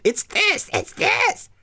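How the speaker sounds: high, strained tip-of-the-tongue cry; inner voice. Retold or imitated. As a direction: squeak it out like the answer is almost there. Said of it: "It's like".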